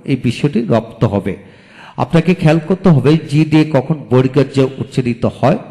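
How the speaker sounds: ordinary delivery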